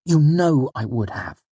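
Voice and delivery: high, hurt insistence; injured tone. A narrator's reading. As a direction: insist with wounded pride, starting high and falling. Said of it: "Bast's tone was injured".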